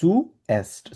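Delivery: "zuerst" split into zu, then erst; syllable-by-syllable demo. Shown as a demonstration. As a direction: break "zuerst" in two for a learner. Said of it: "এটাকে আমরা বলি". ("zuerst", ভেঙে ভেঙে "সু, এস্ট")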